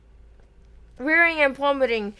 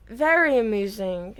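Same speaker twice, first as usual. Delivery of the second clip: ordinary delivery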